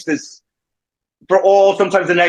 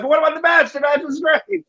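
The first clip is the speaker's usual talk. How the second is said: mock whiny son. Son protesting to mom about the match, high whiny mock voice. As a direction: whine back about the match, pitched up.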